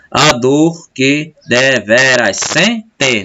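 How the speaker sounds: "A dor que deveras sente" very slowly, syllable by syllable, "sen" stressed; scanning the syllables. Shown as a demonstration. Teacher scanning the last verse, slow syllables stressing "sen". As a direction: beat out each syllable, setting "te" of "sente" apart. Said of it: "uma paroxítona".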